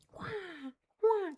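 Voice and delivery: high, hushed imitation eagle screeches; soft eagle cry. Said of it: "That's the eagle".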